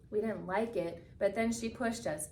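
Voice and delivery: ordinary delivery, nothing marked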